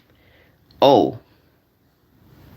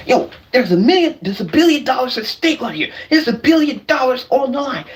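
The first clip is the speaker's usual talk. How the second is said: mock greedy gambler; 5 semitones higher, faster than usual. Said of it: "guys, I'm gonna show you".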